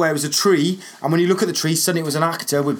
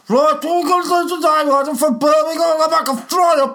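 Rusty Lee impression; 12 semitones higher, 3 dB louder than usual. Mimicking Rusty Lee's loud high Brummie voice for his cohost. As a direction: belt it out high like a TV cook. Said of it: "That's what she sounded like".

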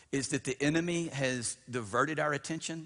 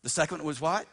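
usual speaking voice

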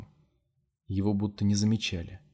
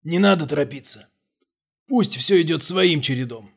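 властный голос Томаша; 6 semitones higher, 8 dB louder than usual. A narrator's reading. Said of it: "Томаш сказал".

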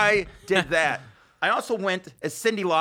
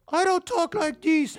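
very high, drawn-out squeaky protest; Brian's voice; slower than usual. Retold or imitated. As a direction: protest in an absurdly high kid voice. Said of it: "And at one point, he goes".

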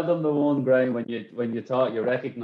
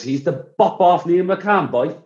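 high, loud, slow mock Cork accent; thick Cork accent; 9 semitones higher, 8 dB louder than usual. Teammate retelling a lookalike claim, thick Cork accent. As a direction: drag it out loudly in broad Cork, through laughter. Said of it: "it was the thickest Cork accent ever".

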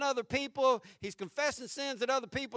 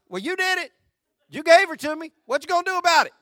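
defiant blame; 5 semitones higher, 10 dB louder, faster than usual. Retold or imitated. Adam blaming God, defiant.

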